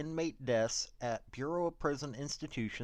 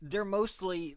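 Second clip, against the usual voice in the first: ordinary talk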